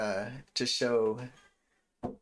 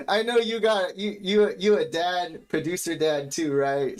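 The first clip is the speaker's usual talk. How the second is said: high, loud, amused delivery; real tickled, raised voice; laughing excitement; 7 semitones higher, 9 dB louder than usual. Real, not acted. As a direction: talk through the tail of a laugh. Laughing recognition of fellow dad.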